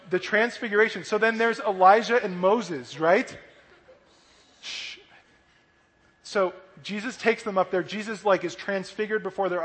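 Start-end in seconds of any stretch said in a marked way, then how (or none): 4.6-5.0 soft drawn-out shush. Real, not acted. Teacher settling the children, soft shush. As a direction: hush the room with a shh.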